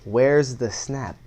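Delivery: usual speaking voice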